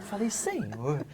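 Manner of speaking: normal talking voice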